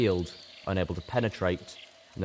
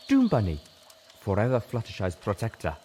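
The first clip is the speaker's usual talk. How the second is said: raised, ringing tribute to a fallen guardian; solemn salute; 3 semitones higher than usual. A narrator's reading. Zebra hails the stone guardian, lightly voiced and solemn. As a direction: honour the old protector proudly.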